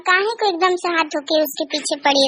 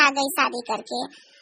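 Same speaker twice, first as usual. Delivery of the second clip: muttered; 4 semitones lower, 8 dB quieter, much slower than usual. Acted. सास बड़बड़ाती है.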